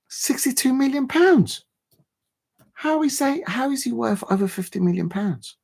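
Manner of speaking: high-pitched real disbelief; incredulous. Real, not acted. Streamer to viewers on the transfer fee, incredulous.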